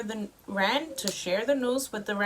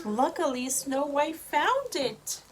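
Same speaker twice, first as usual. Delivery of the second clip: ordinary delivery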